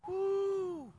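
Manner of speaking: mock whoop. Mimics letting loose at home.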